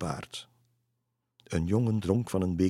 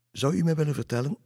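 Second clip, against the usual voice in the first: high, quick polite question; lifted, courteous inquiry; polite request; 9 semitones higher, 4 dB louder, faster than usual. A narrator's reading. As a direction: ask politely in a high, hurried voice. Girl asks a stranger for help.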